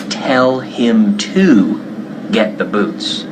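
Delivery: slow teaching model; careful model phrase. Shown as a demonstration. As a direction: spell out "to" slowly. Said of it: "En inglés hay que decir".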